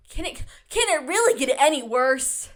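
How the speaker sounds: loud, incredulous rhetorical question; exasperated disbelief. Acted. Host joking with listeners about her insane luck, exasperated disbelief. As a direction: ask it loudly, pitch leaping up on "really". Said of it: "in a way that's like".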